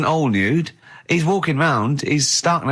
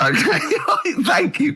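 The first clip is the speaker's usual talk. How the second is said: much higher, laughing through okay and thank you; 11 semitones higher, much slower than usual. Real, not acted. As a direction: thank him while cracking up. Laughing while thanking Carl for gift.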